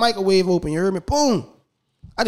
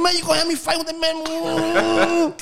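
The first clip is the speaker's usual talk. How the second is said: much higher, slow indignant question; storming complaint; 10 semitones higher than usual. Retold or imitated. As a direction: complain hotly at the setup. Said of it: "I'm storming back down".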